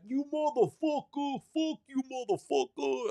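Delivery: very high, strained New York cursing; cursing New York accent. Acted. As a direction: imitate an irate New Yorker yelling back from the street. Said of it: "in that New York accent".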